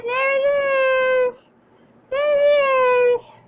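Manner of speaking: very high, slow, drawn-out praise; delighted pet talk. Acted. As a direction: praise the wagging tail. Dog wags tail.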